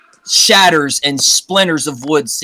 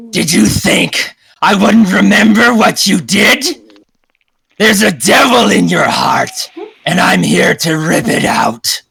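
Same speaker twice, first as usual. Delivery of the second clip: slow, loud monster menace; massive lycanthrope; 4 semitones higher, 4 dB louder than usual. Acted. Lycanthrope confronts the one who wronged her, slow loud threat. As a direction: threaten like a huge vengeful werewolf, slow and forceful. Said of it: "a massive, uh, lycanthrope".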